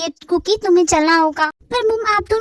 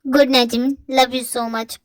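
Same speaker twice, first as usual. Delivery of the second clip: put-on boyfriend voice; 7 semitones lower, slower than usual. Boyfriend wishing girlfriend goodnight, lower fond voice. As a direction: lower male voice, affectionate goodnight.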